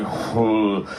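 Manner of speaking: "Who?" let slip out slack, lips loose; lazy slid-out "who". Retold or imitated. Imitating the guy whose word slid out lazily. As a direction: mumble "Who?" with no effort. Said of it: "It just slid out like".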